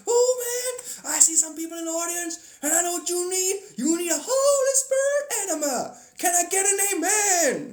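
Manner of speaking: preacher calling out to the congregation, mock fervent; hammed-up preacher. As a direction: belt it like a revival preacher working the crowd. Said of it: "It's like".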